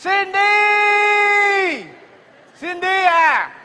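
high, loud, long-drawn shout; calling out. Acted. Man calls out to Cindy on arrival, high drawn-out shout. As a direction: holler the name, stretching it till it drops.

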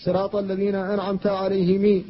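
"عليهم" with a final i, "عليهمِي"; alayhimi reading. Shown as a demonstration. Teacher recites the fourth reading. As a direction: add an i after the م in "عليهم". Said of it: "كلها قراءات".

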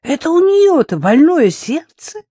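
высоко и громко, с недоверием; не верит ни слову, насмешливо взвизгивая; недоверчиво. Acted. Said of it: "не поверила Рита". (недоверчивое восклицание Риты про свекровь)

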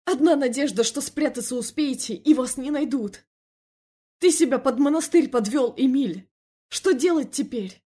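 loud, high-pitched reproach; angry tirade. A narrator's reading. Felix warning Emil they will be hunted, angry. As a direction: rail at him, urgent and exasperated.

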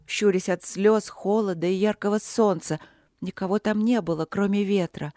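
ordinary delivery, nothing marked

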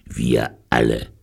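low, blunt convict voice; gruff convict. A narrator's reading. Convict including all his friends in the crime, gruff. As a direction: say it low and rough.

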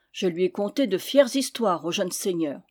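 usual speaking voice

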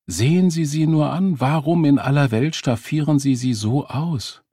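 high, pressing reproach; reproachful challenge. A narrator's reading. Man reproaching mother over her daughter's outfit, insistent.

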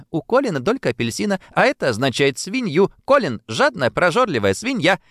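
high, fast gleeful teasing; delighted teasing cry. A narrator's reading. Girl teases the greedy boy, gleeful and fast. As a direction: crow over him with delight. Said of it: "восхищённо вскричала Бриджит".

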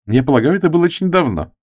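a light dig at his age; girl teasing an older man; sarcastic. Acted. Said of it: "нотки сарказма в тоне девушки".

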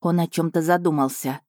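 ordinary delivery, nothing marked

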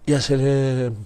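ordinary talk

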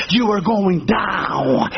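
low, very slow pronouncement; mock doom. Preacher warning the proud passenger, slow mock-ominous. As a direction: draw it out low like a verdict.

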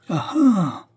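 high-pitched exclamation of a jeering crowd; crowd cry. A narrator's reading. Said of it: "cria la foule". (clameur de foule)

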